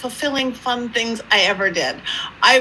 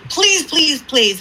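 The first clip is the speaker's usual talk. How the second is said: higher-pitched mock begging; mock pleading; 5 semitones higher, 4 dB louder, slower than usual. Caller begging the medium for a seance, mock pleading.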